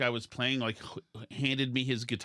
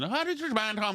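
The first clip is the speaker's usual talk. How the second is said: Irish brogue; 7 semitones higher than usual. Retold or imitated. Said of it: "in a bit of an Irish brogue".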